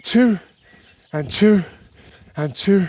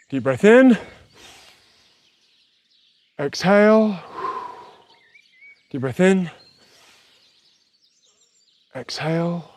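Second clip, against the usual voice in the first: guided breathing; 3 semitones lower than usual. Real, not acted. Leads cooldown breathing.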